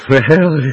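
loud knowing chuckle. Acted. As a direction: chuckle it off, amused. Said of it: "said Uncle Andrew with a chuckle".

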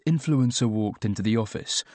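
ordinary delivery, nothing marked

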